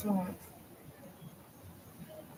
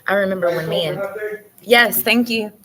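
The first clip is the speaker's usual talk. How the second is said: ordinary talk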